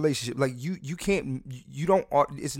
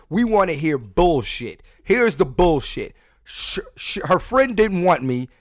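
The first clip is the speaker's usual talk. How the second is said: louder slow hype; 4 semitones higher, 7 dB louder, slower than usual. Real, not acted. Loud rant to listeners.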